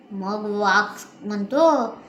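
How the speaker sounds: plain reading voice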